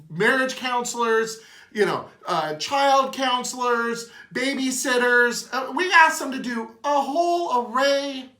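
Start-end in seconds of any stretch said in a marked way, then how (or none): 2.6-8.3 emotional. Real, not acted. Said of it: "I'm getting a little emotional".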